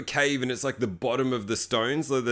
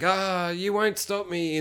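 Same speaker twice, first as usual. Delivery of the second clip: higher, slow strained defiance; defiant hero; 6 semitones higher, slower than usual. Retold or imitated. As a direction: grit it out slowly, higher. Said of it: "he's like".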